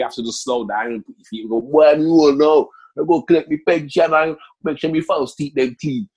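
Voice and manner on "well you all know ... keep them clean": mock complacent pensioner. Imagined complacent older self.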